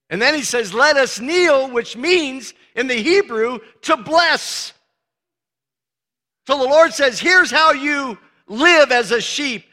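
loud, high fervent preaching; preaching at full force. Real, not acted. Preacher to congregation, full-force loud preaching. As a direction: build it up and preach.

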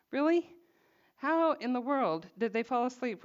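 sharp high disbelieving rise; can you believe it, jumping way up; incredulous really. Real, not acted. Preacher marvelling at the sleeping disciples, incredulous.

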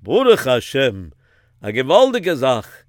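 put-on old Rav with a Yiddish accent; elderly rabbi in a Yiddish accent. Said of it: "And then the Rav said".

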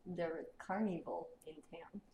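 put-on quiet heavy Russian accent. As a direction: speak like a Russian giant. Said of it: "He says in, in common".

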